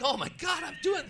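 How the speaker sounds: high, loud thrilled cry; excited shout. Retold or imitated. First-time caller overwhelmed as the cars come by, excited yell.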